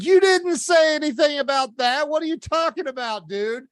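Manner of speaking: loud, high-pitched indignant outburst; upset protest. Retold or imitated. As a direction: retell it as a heated shout at your buddy. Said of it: "now I'm upset".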